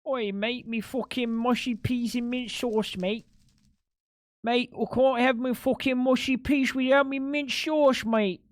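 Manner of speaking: put-on playful over-the-top Cockney accent; mock British geezer. Mocking British stereotype after chat line.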